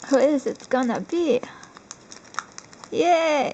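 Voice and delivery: ordinary talk, nothing marked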